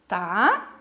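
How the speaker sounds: ordinary talk, nothing marked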